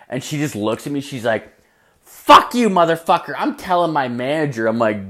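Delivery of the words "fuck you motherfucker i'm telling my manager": put-on high, loud furious girl voice; imitating her yell. Girl yelling at the comic, high furious shriek. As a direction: shriek it at him in a fury.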